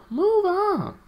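very high, loud drawn-out call; playful squeal. Pretend. Streamer to viewers about moving on, playful squeal. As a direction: sing it out high like a silly creature.

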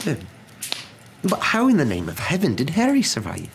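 high-pitched, overwhelmed disbelief; faltering. A narrator's reading. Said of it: "faltered Professor McGonagall".

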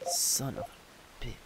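slow, hushed swearing; defeated whispered curse. Real, not acted. Player who lost all that crap, quietly defeated. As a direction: curse slowly under your breath. Cursing after losing items.

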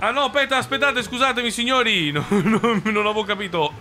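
high-pitched panic breaking into laughter; flustered laughing apology. Real, not acted. Bus driver to passengers after a blunder, flustered and laughing.

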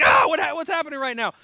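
high, loud startled shout; panicked yell. Retold or imitated. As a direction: yelp it out like a man terrified by an angel. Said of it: "Goes nuts".

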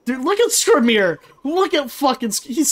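high, thrilled exclamation; excited fan. Real, not acted. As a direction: gush at spotting a favorite character. Spots favourite character on screen.

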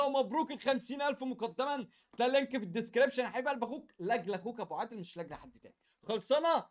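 ordinary talk

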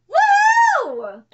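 high, loud whoop; real triumphant whoop; real cheer. Real, not acted. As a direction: celebrate the win. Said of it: "celebrates villain death".

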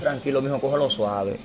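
calm him down gently, almost under the breath; soothing hush. Retold or imitated. God hushing the weeping man, a soft tender hush. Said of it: "como decía".

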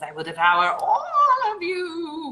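monster voice. Acted. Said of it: "could I get flesh bones?".

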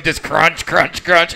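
ordinary delivery

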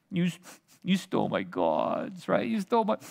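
pouty, whimpering mock complaint; lightly whimpering pout; mock sniveling. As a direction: snivel it like a sulking boy. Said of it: "like a pouty lip".

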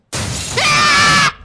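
high startled shriek; scared scream. Real, not acted. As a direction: scream in fright.

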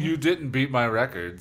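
ordinary delivery, nothing marked

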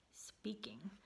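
whispered secret. A narrator's reading. Reader telling children the forbidden rule, whispered. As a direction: let the word slip out unvoiced. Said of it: "reader whispers the broken rule".

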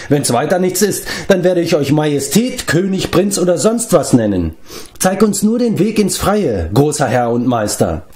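high-pitched, exasperated flattery; impatient exclamation. A narrator's reading. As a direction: grant the titles impatiently, pitched well up. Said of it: "rief Leftur". (ungeduldig ausrufend)

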